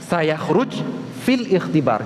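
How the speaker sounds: each word slowly drawn out; measured repeat for the class; slow deliberate repeat. Shown as a demonstration. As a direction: spell out Sayakhruj fil ikhtibar slowly. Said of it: "Gimana kira-kira itu?".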